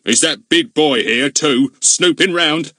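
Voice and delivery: slow, loud suspicious policeman; suspicious grilling. A narrator's reading. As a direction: press him hard. Goon interrogates Ern.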